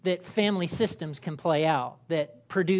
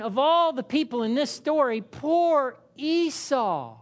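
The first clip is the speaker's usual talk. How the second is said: mock pity; 6 semitones higher, 3 dB louder than usual. Congregation member pitying Esau, hammed-up sympathy. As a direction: drag out poor Esau with fake pity. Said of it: "some of you might be going".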